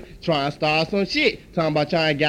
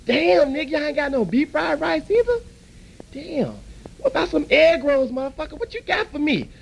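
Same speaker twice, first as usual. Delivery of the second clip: higher, exasperated customer; irritated customer; 9 semitones higher than usual. Acted. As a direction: push back at the counter, fed up. Customer gets angry at the counter.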